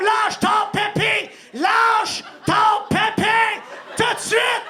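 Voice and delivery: high, slow stern shout; angry mother shouting at her toddler; mother yelling. Acted. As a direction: bark the order like a furious mom. Mother orders kid.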